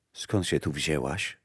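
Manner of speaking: hushed, low note of surprise; surprised. A narrator's reading. Death asks the mother how she got here, mildly surprised. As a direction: ask softly and low, mildly taken aback. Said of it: "spytała zdziwiona".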